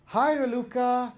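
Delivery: high, loud, slow and cheerful hello; loud friendly greeting. Shown as a demonstration. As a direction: greet her brightly while insulting her. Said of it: "greet me in a very friendly and loud way".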